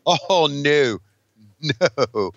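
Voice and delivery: ordinary delivery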